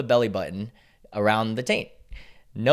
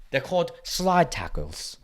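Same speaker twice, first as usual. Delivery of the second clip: higher, hammed-up British accent; mock British voice, pitched up; bad British accent; 6 semitones higher than usual. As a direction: put on a badly faked British accent. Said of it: "that was a bad British accent".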